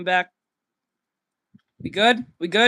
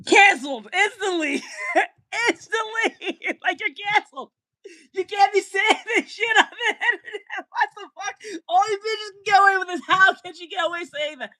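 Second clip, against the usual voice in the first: laughing; 8 semitones higher than usual. Real, not acted. Laughing outrage.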